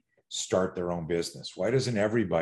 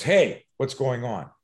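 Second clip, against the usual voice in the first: louder, drawn-out challenge on "Hey"; calling out; 3 semitones higher, 5 dB louder, slower than usual. Acted. Someone steps in at a kidnapping, calling out. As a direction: call out to stop something.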